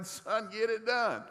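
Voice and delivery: high slow proud coach voice; hammed-up cheer. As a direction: stretch it out like a coach. Dad cheering son on.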